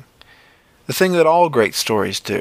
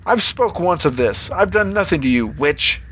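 protesting; 7 semitones higher than usual. A narrator's reading. Farmer protests to the witch.

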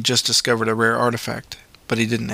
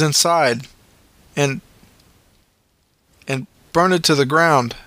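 higher, grave paramedic voice; paramedic recounting; 5 semitones higher than usual. A narrator's reading. Paramedic telling Luke about the burned workhouse, grave. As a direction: tell the dark history gravely.

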